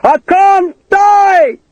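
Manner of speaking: long, very high loud howl; calling out. A narrator's reading. Man in the pit calling up to enemy, long high shout. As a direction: stretch each word into a slow, high yell. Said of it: "Hakeswill called again".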